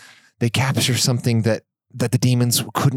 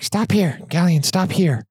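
calling out; 8 semitones higher than usual. Acted. Lulu NPC calling out to stop.

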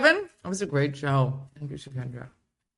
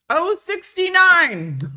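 slow, much higher and louder called-out number; bingo caller; 12 semitones higher, 8 dB louder, much slower than usual. Pretend. Calling a number to the group in the stream, like a bingo caller. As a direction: drag it out like a bingo hall announcer.

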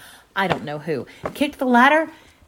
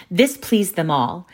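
ordinary delivery

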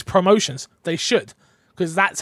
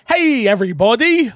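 reading the greeting; 6 semitones higher, 7 dB louder than usual. Retold or imitated. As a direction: call it out like a big hello. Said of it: "your version of hello".

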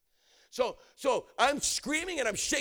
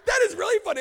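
high, loud, laughing outburst; real amusement; 14 semitones higher, 11 dB louder, faster than usual. Real, not acted. Minister cracking up at his own joke, laughing. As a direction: burst out, tickled by your own joke.

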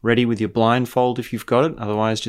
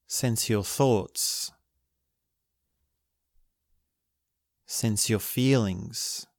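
guided meditation; 5 dB quieter than usual. Acted. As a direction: guide them gently into stillness.

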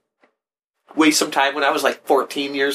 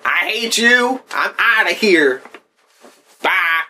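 mock outrage; 7 semitones higher, slower than usual. Mock storming off.

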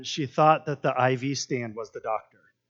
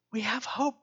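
higher but softer, tender repeat; preacher repeating hope softly; soft echo; 7 semitones higher, 5 dB quieter than usual. Acted. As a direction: say it again gently. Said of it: "repeats the line for emphasis".

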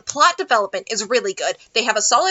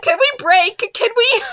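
pitched high and louder, begging to stop; giggly plea for a break; 9 semitones higher, 4 dB louder than usual. Retold or imitated. Actress cracking up begs the director, giggly and high. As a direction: ask for a break through the giggles.

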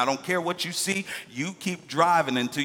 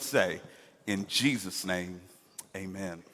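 prayer; 5 semitones lower than usual. Acted. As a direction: pray humbly to the Father.

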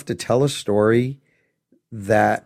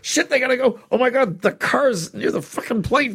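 tense panic; 13 semitones higher than usual. Retold or imitated. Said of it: "I'm like".

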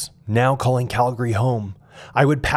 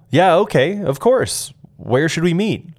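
higher, brighter, upbeat "Yeah, okay, of course"; eager friend; 6 semitones higher, 3 dB louder than usual. Retold or imitated. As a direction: jump right in, glad to help.

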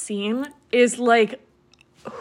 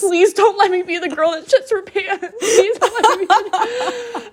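laughing plea; 9 semitones higher than usual. Retold or imitated. Retold desperate bride, laughing through.

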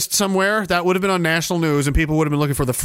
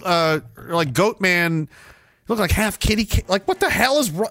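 ordinary talk

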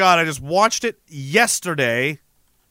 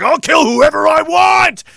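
slow, much higher, much louder roar; mock brute yell; 11 semitones higher, 7 dB louder, slower than usual. Imitating an overpowered wrestler bullying kids, a hammed-up slow shout. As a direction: bellow it like a juiced-up wrestler. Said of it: "And he's just like".

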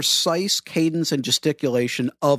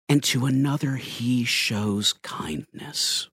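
swooping megachurch cadence with dramatic pauses; hammed-up megachurch sermon delivery; generic megachurch pastor; 4 semitones higher than usual. Shown as a demonstration. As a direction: preach it, stretching the big words and pausing for effect. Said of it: "generic megachurch pastor voice".

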